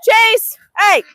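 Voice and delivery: loud high shout at the dog; yelling at dog. Real, not acted. Owner shouting at dog Chase grabbing a goose, loud yell.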